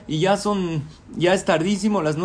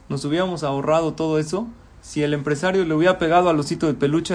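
usual speaking voice

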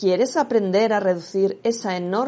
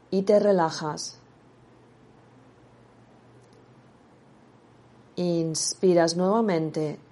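guided meditation. Acted. Meditation guide leading listener through relaxing breaths, guided meditation voice.

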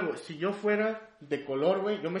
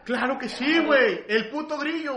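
loud, high-pitched protest; loud comic outrage; heated insistence; 8 semitones higher, 7 dB louder than usual. Real, not acted. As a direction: shout it as if it's obvious. Heated mock argument over best mascot.

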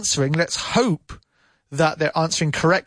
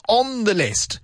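"on" punched higher; stress on ON; 5 semitones higher, slower than usual. Shown as a demonstration. Teacher corrects preposition, stressing "on" firmly. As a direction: hit the word "on" to correct "in". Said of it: "but she's definitely on The list".

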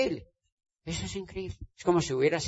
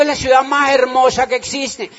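repeated louder and higher, insisting; 8 semitones higher, 9 dB louder than usual. Retold or imitated. Asked again about Cali, he repeats it louder and proud. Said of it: "¿Ah?".

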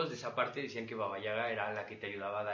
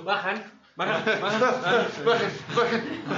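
loud, high, slow calling of stops; bus driver call; 8 semitones higher, 9 dB louder, much slower than usual. Retold or imitated. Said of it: "tú".

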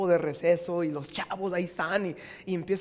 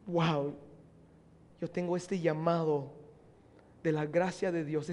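awed Paul; 6 semitones lower, 9 dB quieter than usual. Acted. Paul awed by his calling.

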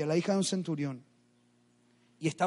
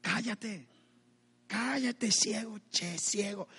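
hushing; 3 semitones higher, 6 dB quieter, much slower than usual. Retold or imitated. Crowd to the blind man crying out, hushing him. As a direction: shush him under your breath. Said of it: "Todos le callaban".

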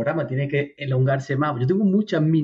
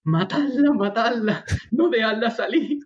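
higher urgent chant broken by laughter; retold yelling, laughing; 7 semitones higher than usual. Narrator retelling what neighbors heard, laughing high mock yells. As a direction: quote the shouts while cracking up. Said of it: "se escuchaba decir".

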